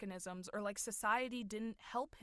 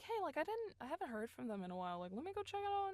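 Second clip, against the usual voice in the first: higher, light thinking aloud; breezy acted thought; 7 semitones higher, faster than usual. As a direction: play innocently wondering to yourself. Acting out own breezy thought.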